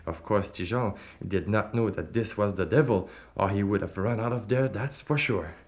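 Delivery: plain reading voice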